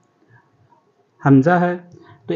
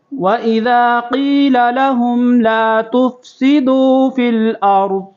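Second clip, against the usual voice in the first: slow, higher melodic tajweed chant; Quran recitation; 5 semitones higher, 4 dB louder, much slower than usual. Shown as a demonstration. Teacher recites the verse for students to repeat, in melodic chant. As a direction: recite it melodiously, stretching the long vowels.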